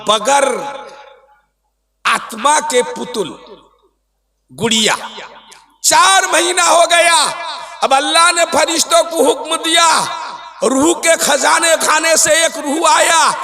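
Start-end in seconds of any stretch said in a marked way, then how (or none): 5.8-13.3 higher, ringing sermon delivery; preaching. Acted. Preacher telling the congregation how the soul arrived, impassioned preaching. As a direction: preach it out in raised, sustained cries.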